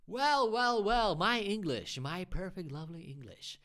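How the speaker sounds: hammed-up grand boast, starting high and loud, then trailing off; mock self-praise. To a viewer who praised his English, mock boastful. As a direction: play it like a smug showoff, tongue in cheek.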